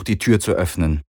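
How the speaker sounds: plain reading voice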